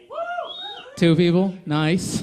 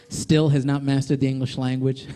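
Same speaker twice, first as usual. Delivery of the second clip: usual speaking voice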